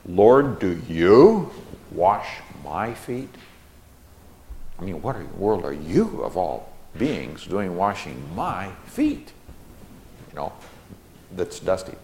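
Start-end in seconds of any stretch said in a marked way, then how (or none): 0.1-3.3 drawn-out question, YOU leaning hard and MY stressed; very slow, incredulous reading with weight on YOU and MY; emphatic-word reading. Shown as a demonstration. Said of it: "this is how you read it". 4.8-9.3 incredulous question with long, weighted YOU and MY; emphatic paraphrase. Shown as a demonstration. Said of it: "this is how you read it".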